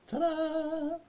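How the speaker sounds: high squeaky ta-da, showing off the picture; mock fanfare. Vlogger unveils the naked vandals photo, high mock fanfare. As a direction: sing it out like a magician's reveal. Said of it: "this is stunning".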